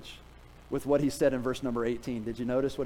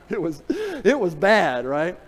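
laughing; 3 semitones higher, 5 dB louder, slower than usual. Real, not acted. Laughing over the story.

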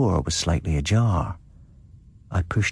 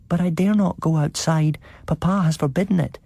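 put-on high little child voice; 10 semitones higher than usual. A narrator's reading. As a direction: speak like a timid small child.

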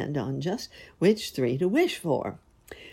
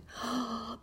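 soft gasp; 7 semitones higher, 11 dB quieter than usual. Acted. Narrator gasps at magic.

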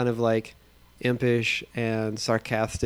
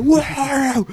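sudden high barked yell, louder; overly intense shout; 13 semitones higher, 5 dB louder than usual. Retold or imitated. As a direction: blurt it out as a high, loud, over-the-top yell. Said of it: "he delivers it like".

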